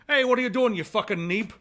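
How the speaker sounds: loud, high mock Scottish accent; Scottish translation. Translator turning the insult Scottish, loud accent. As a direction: bellow it like an irate Scot. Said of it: "it's gonna be like".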